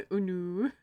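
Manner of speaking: laughing through the words. Real, not acted. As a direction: crack up mid-line. Laughing at own joke.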